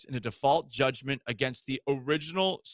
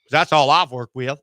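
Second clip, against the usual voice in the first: louder, lightly put-on twangy coach voice; a hint of a good-old-boy coach voice; put-on coach accent; 6 dB louder than usual. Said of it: "I gave him this accent".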